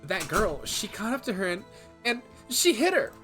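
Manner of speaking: put-on bubbly Valley Girl accent, rising high. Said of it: "Do a Valley Girl accent?".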